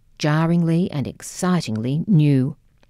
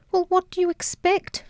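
a much higher, biting snap; snapped. Acted. Grieving mother snaps at her smirking daughter, much higher. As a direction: bite the words back at her, high and fierce. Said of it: "she snapped".

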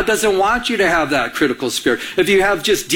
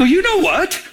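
much higher, indignant retort; mock indignation; 11 semitones higher than usual. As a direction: re-enact snapping back at your wife. Acted quarrel with his wife.

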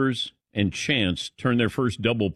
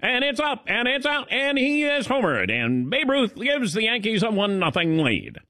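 high-pitched vintage play-by-play, hammed up; old-time radio broadcaster; 9 semitones higher than usual. Host imitating a radio call of Babe Ruth, old-time broadcaster voice. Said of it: "They sounded like that".

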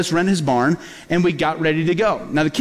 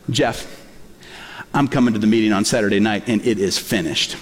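voice of God; 9 semitones lower than usual. Retold or imitated. Pastor voices God speaking to him.

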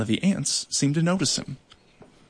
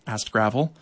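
plain; 3 semitones lower than usual. A narrator's reading. Narration tag after the quote.

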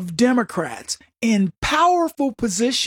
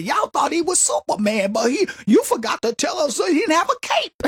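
higher, shouted punchline in a light comic voice; Cat Williams impression; 6 semitones higher than usual. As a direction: belt the joke like a stand-up comic. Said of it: "It's like Cat Williams said".

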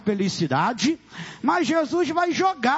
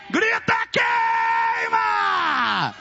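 shouted cry; 2 semitones higher, much slower than usual. Real, not acted. Pastor commanding the crowd to cry out, shouted chant. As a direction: scream it out and stretch the last word.

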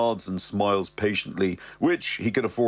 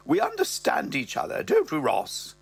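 higher mother voice; mother's voice; 8 semitones higher than usual. Acted. Mother confiding to Ross, higher voice. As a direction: lift into the old dear. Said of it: "the old dear is there".

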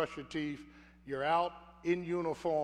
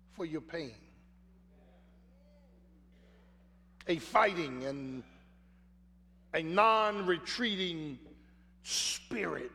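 louder, building sermon cadence, stretching key words; rising preaching; 3 semitones higher, 4 dB louder than usual. Acted. Preacher exhorting the congregation, louder rising sermon voice. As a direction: preach it with growing force.

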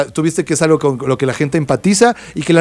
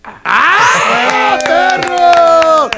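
excited shout; 13 semitones higher, much slower than usual. Real, not acted. Cheering after the song intro.

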